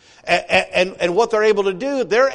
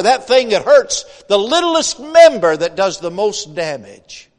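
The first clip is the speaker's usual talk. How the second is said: loud, higher, driving sermon voice; preaching at full force; 4 semitones higher, 6 dB louder than usual. Acted. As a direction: preach it to the congregation with fire. Preaching builds on whining and tongue.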